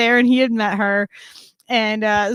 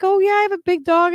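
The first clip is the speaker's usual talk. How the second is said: lightly voiced lady, higher and quick; bubbly stranger at the store; chatty stranger; 9 semitones higher, faster than usual. Retelling a lady in the store, lightly higher and quicker. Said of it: "And she's like".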